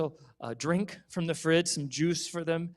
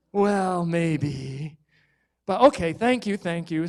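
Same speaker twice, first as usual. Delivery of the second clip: reluctant thanks; 6 dB louder, slower than usual. Acted. Mom forced thanks.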